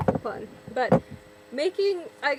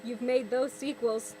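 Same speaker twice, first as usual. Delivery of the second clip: ordinary delivery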